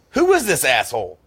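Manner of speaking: loud, high mock outrage; indignant dad. Dad asking his son who the cop was, indignant.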